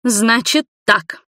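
imperious mother laying down the law; loud and commanding. A narrator's reading. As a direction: take charge of the room, slowly. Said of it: "громко и властно заговорила мать Игоря".

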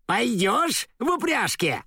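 high shrill question. Acted. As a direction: screech it at the lion. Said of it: "визгливо спрашивала льва".

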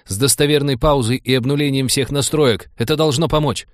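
high, loud urgent shouting; yelling orders. A narrator's reading. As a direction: keep shouting the commands. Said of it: "заорал кибертехнолог".

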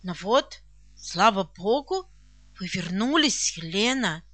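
low, halting words with emotion held in; moved, agitated. A narrator's reading. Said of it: "произнесла взволнованно". (взволнованно, низким голосом, с паузами)

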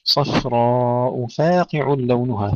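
صفراء with thin ف between heavy ص and ر; slow recital, heavy-light-heavy in صفراء; light fa between heavies. Shown as a demonstration. Teacher modelling the verse, thin fa. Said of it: "ترقيق الفاء بين المفخمين".

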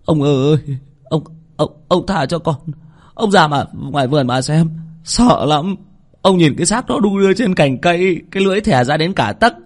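high, pleading, stuttering servant; stammering in fear. Acted. Terrified servant stammers, begging the master to spare him. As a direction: beg with a trembling stammer. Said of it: "Thằng Tý lắp bắp".

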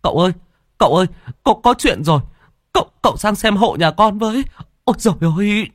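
high, stammering cry for help; panicked. Acted. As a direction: plead in a panic, pitch shooting up. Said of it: "mặt tái xanh tái xám". (giọng hoảng hốt cầu cứu)

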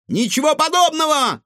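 high, loud shout; shouting back. A narrator's reading. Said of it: "крикнул в ответ Егор".

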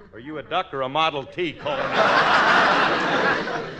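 ordinary delivery

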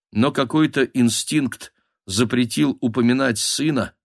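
plain reading voice

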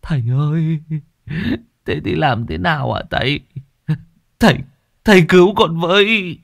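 high tearful plea; whimpering plea. Acted. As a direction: beg the master to save you. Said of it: "đến đây thì mếu máo".

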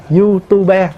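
YouTuber split as "you-tu-be"; letter-by-letter reading. Shown as a demonstration. Monk explains transliterating each piece, syllable-by-syllable pronunciation. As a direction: give YouTuber three syllables, ending on "be". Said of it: "người ta phiên âm từng chữ".

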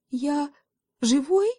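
high, rising question in wonder; tentative, high-pitched amazement; astonished question. A narrator's reading. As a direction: ask it in disbelief, lifting sharply on живой. Dazed kid checks he survived.

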